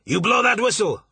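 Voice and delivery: loud, high command called upward; shouted order. Acted. Marsh ordering the pilot to blow the whistle, loud shout. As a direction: bark the order up to him.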